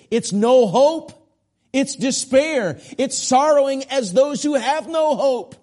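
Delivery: high, loud, slow and emphatic cry; impassioned preaching. Real, not acted. Preacher warning the unsaved of hopelessness, impassioned cry.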